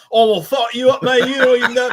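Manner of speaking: loud, high British trash talk; hammed-up angry British gamer; mock UK accent. British players talking shit online, loud mock UK accent. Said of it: "they'd be like".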